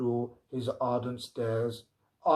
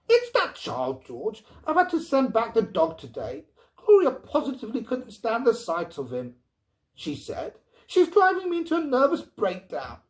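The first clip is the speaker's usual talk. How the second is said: high, loud exasperated complaint; a fed-up mother ranting; 10 semitones higher, 6 dB louder than usual. A narrator's reading. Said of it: "looked boiling mad".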